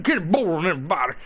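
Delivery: mocking a bad imitation; garbled mock impression, poking fun at the caller; Kenny Bolin impression. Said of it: "his real Kenny Bolin impression".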